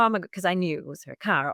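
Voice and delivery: ordinary talk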